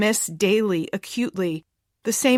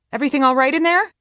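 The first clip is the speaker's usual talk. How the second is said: higher, fast call from another room; 7 semitones higher, 5 dB louder, faster than usual. A narrator's reading. Carol calling to her daughter from the kitchen. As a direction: call through the house, quick and bright. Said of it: "Carol calls".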